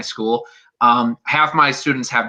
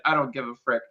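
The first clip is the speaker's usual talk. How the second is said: normal talking voice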